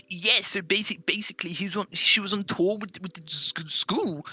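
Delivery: high, exaggerated stammering imitation; housemate impression, stuttering. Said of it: "He, he has that tone of voice".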